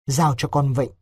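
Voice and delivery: plain reading voice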